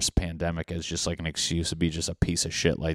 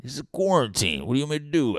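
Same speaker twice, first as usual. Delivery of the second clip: higher, whiny mock exasperation; mock pleading excuse; 6 semitones higher, faster than usual. Cheating boyfriend to girlfriend, high whiny mock excuse. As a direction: play the cheater throwing up his hands.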